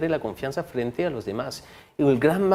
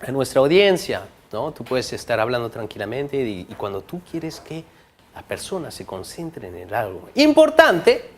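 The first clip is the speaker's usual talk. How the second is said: normal talking voice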